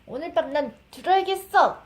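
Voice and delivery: fast, high, loud demanding king. Acted. Mother voicing the king for her baby, a fast, loud insistence.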